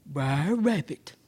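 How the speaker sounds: low, slow bear voice; lumbering, softened bear; puzzled bear. Acted. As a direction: say it deep and drawn out. Bear addresses rabbit.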